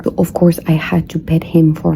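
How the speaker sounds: ordinary talk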